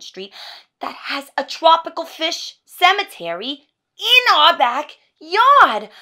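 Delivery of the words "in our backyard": very loud, high, drawn-out indignation; over-the-top outburst, stretching each word; outraged. Acted. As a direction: wail it in exasperated disbelief. Rant peaks on repeated phrase.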